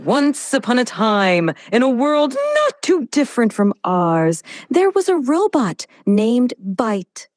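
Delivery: hammed-up, sweeping dramatic storyteller; theatrical tale, big swings in pitch; maximal drama. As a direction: tell it with maximal expressiveness. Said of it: "I really want maximal emotion".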